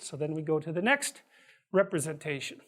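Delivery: ordinary delivery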